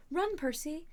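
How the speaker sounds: urgent shout. A narrator's reading. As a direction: call out urgently to flee. Annabeth calls a warning.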